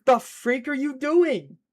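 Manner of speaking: high, loud, drawn-out question; slow, incredulous holler; outraged shout. Real, not acted. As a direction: yell in exasperated disbelief. Protesting at chat.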